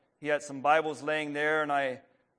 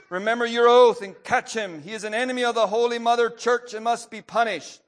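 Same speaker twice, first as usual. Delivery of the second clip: higher, louder and faster angry order; angry command; 8 semitones higher, 8 dB louder, faster than usual. A narrator's reading. Burmaster ordering the thief catcher to keep his oath, angry. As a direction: drive the command home with rising anger. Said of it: "growing angry".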